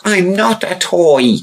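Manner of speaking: very high, slow eerie doll voice; a living doll pleading, high and slow; creepy doll voice. Acted. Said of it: "said the doll".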